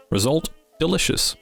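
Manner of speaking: usual speaking voice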